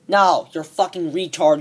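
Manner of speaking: ordinary delivery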